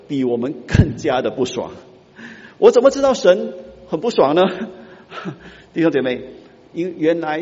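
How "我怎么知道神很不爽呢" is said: raised, louder challenge to the flock; rhetorical exclamation. Real, not acted. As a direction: throw the question out boldly. Preacher's rhetorical outburst. (激动地反问)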